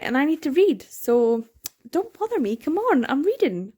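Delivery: high, fussy shooing; mock annoyed. Reader telling the characters to let her read, mock annoyed. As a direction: brush them off like a busy reader.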